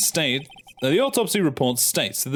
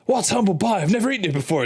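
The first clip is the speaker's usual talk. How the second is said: ordinary delivery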